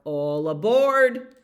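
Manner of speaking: slow, loud conductor's call; drawn-out loud all aboard. Acted. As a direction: call the whole train aboard. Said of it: "the hamster calls out".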